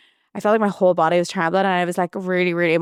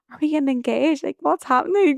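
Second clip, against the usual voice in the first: nervous question; 8 semitones higher than usual. Retold or imitated. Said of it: "I was so nervous".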